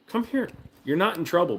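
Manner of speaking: high gentle coaxing to dog. Real, not acted. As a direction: lure him in sweetly.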